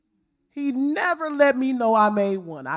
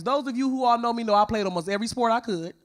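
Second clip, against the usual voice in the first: ordinary delivery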